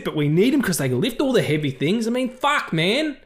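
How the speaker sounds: high, exasperated Australian tirade; Elrond as ranting Australian. Acted. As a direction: rant like a fed-up host clearing out the house. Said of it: "that was quite the rant".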